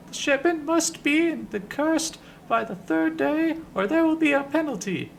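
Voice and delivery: high falsetto mantra recitation; Ben the Sender. Acted. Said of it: "recites the message like it's a mantra".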